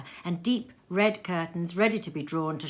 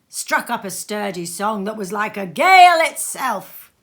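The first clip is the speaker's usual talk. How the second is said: louder, rousing like a gale; much louder, gale-like; 3 semitones higher, 10 dB louder than usual. A narrator's reading. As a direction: swell the voice like wind. Sturdy song like gale.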